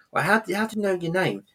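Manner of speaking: high-pitched puzzled questioning; confused. Retold or imitated. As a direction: act out baffled disbelief, pitch leaping up. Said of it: "he's a bit confused".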